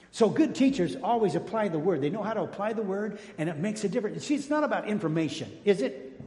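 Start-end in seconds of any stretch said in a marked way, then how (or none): none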